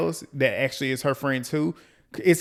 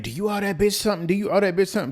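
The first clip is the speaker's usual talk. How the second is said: mock scream; 5 semitones higher, faster than usual. Retelling Biggie screaming at Tasiki, mock scream. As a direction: imitate a screamed demand without the volume. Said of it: "And she's screaming".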